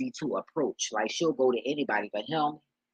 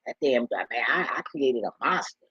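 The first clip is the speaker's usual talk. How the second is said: ordinary talk, nothing marked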